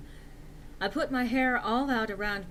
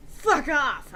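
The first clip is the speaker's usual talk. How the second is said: loud, high angry snap; snapped; 6 semitones higher, 8 dB louder than usual. Acted. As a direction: bite it off at him. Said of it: "I snapped".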